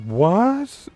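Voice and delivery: high, incredulous Rocket squeak; baffled Rocket. Retold or imitated. Rocket reacting to Peter's voice, high and baffled. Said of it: "he's so baffled".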